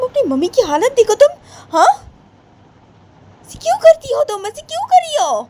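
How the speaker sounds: a grandma rising to shrill reproach; scolding grandma. Acted. Grandmother scolds the naughty child.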